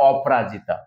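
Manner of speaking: অপরাজিতা run together as op-ra-ji-ta; colloquial contracted form. Shown as a demonstration. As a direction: drop the vowel after p in অপরাজিতা. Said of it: "বাঙালি উচ্চারণ করে".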